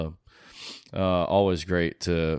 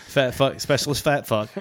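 a hint of a barking drill sergeant; higher, louder sergeant taunt; sergeant major voice; 6 semitones higher, 4 dB louder than usual. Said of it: "my sergeant major looked at me and he's like".

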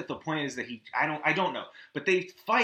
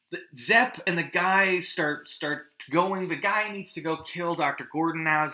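ordinary talk, nothing marked

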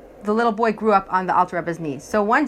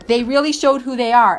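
normal talking voice

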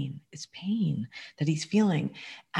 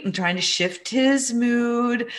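normal talking voice

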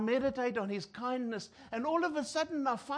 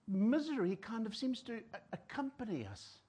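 usual speaking voice

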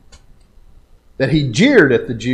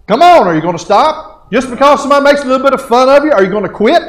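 loud, raised-pitch challenge from the pulpit; challenging preaching; 11 semitones higher, 9 dB louder, faster than usual. Real, not acted. Preacher daring the congregation not to quit, challenging. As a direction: push them hard, like a fired-up preacher.

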